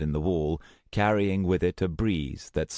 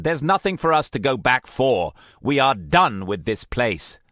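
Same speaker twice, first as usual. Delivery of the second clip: louder, higher angry declaration; angry insistence; 7 semitones higher, 6 dB louder than usual. Acted. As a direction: slam the door on it. Frustrated refusal.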